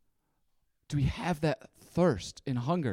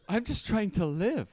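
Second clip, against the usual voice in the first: mock plea; 6 semitones higher than usual. Acted plea in own story.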